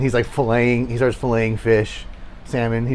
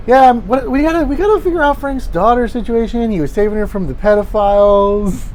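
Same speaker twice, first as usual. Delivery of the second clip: high-pitched character voice; Sylvia; 11 semitones higher, 4 dB louder than usual. Acted. Character urging the group about Frank's daughter, in a high voice. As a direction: pitch it well up and chatter insistently. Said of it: "Sylvia's over here like".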